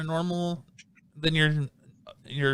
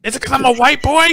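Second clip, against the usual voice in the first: much higher, louder mock yell; mock scream; 11 semitones higher, 10 dB louder than usual. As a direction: imitate a man screaming in the street. Imitating screaming man at night.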